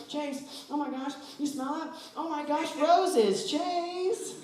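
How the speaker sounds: high, swooning mock delight; dreamy, lovestruck over roses; gushing smeller. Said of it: "They're never like".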